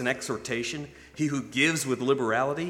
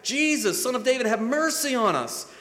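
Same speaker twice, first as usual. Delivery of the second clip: higher, louder desperate plea; louder cry; 10 semitones higher, 4 dB louder than usual. Retold or imitated. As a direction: cry out even louder for mercy. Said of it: "cried out all the louder".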